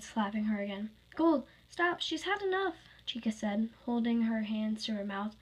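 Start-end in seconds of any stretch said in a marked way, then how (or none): 1.1-2.8 high, pleading intervention; raised, urgent protest; urgent plea. Acted. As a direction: cry out to stop the attacker. Friend intervenes to stop a beating.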